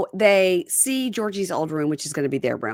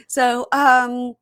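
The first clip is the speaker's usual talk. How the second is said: usual speaking voice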